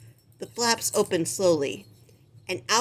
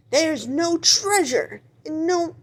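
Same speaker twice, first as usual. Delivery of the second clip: much higher, slow wailing sob; hammed-up sobbing; 9 semitones higher, 4 dB louder, slower than usual. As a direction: wail it out in mock despair. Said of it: "sobbed Polly Lobster".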